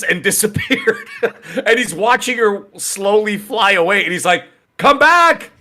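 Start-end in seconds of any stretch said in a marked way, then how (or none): 4.8-5.5 high, louder mock call after someone; imitating a yell. Streamer calling after a woman floating into space, mock yell. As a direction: call out as if she's drifting away. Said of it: "like, yelling at it".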